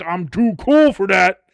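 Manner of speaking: loud, slow, hammed-up scoffing; blustering mock disdain; mock miserable grump. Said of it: "be fucking miserable and be like".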